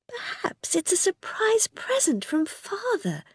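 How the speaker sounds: a mother hopefully wondering aloud; mother's voice. Acted.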